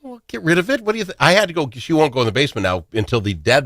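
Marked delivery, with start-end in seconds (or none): 0.0-1.2 much higher, half-sung mock exasperated reply; high mock exasperation. Host replaying his answer to his wife, high and exasperated. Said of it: "And I'm like".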